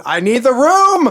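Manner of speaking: loud, high, drawn-out mock demand; Michael Ironside. Quoting the film villain over a body, hammy yell. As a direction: holler it hammily, stretching the last word. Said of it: "Michael Ironside said".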